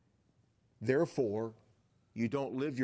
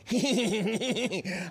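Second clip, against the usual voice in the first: high, giggly prankster laugh; a kid's sneaky laugh from the cockpit; mischievous cackle; 9 semitones higher, 4 dB louder than usual. Retold or imitated. The grown-up prankster pilot laughing at his passenger, high and mischievous.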